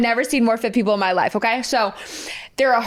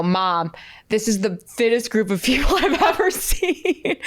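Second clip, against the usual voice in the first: breaking into laughter. Retold or imitated. Telling mom, breaking into laughter.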